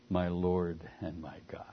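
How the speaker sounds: a reverent whispered confession; hushed whisper. Acted. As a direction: breathe it out softly like a prayer. Quoting Thomas's confession.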